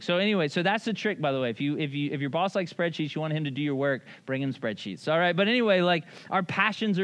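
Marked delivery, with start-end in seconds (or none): none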